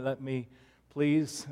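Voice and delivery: ordinary delivery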